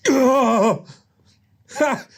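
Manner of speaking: high hammy scoff and laugh; mocking scoff. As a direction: scoff in disbelief. Nick's scoffing reaction.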